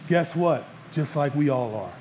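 ordinary delivery

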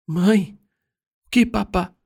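high-pitched daughter's voice; frightened daughter. A narrator's reading. Daughter startled by her father's crying asks her mother, frightened high voice.

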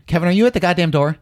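calling out. Real, not acted. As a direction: yell to Kevin at the door. Calling to Kevin offstage.

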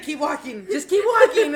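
acted urgent alarm. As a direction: hurry a friend away in mock panic. Coworker urging escape, retold.

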